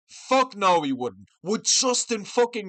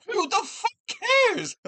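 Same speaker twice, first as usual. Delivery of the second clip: much higher pitched, slow disbelief; incredulous scoff; 14 semitones higher, slower than usual. Real, not acted. Mocking Arman's grappling match, high incredulous scoff. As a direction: sneer at how pointless it is.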